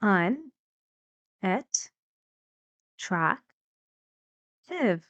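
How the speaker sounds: UNATTRACTIVE split into un, et, track, tive; four separate beats with a long pause after each syllable. Shown as a demonstration. Teacher showing students the four syllables, "Unattractive" sounded out piece by piece. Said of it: "It's four syllables".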